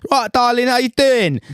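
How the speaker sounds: put-on high, loud, over-the-top Essex accent; a bad, hammed-up Essex accent; Gemma Collins Essex accent. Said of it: "I'm gonna do a very bad Essex accent here".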